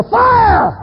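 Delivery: loud, high-pitched alarm shout; screaming. Acted. Preacher warning someone on a burning roof, screaming. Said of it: "And I'm screaming".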